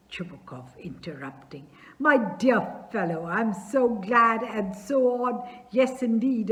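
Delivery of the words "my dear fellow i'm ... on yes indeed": father embracing the suitor, loud and gushing; overjoyed gushing. Acted. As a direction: pour out the joy. Chubukov overjoyed embraces Lomov.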